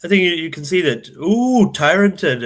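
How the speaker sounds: excited. Real, not acted. Commentator sees big play.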